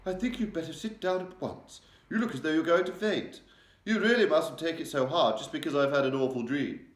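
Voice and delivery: high, loud, brisk order; sharp queenly command. A narrator's reading. The Queen ordering pale Mary to sit, sharp and brisk. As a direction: sternly tell the maid to sit at once. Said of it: "rather sharply".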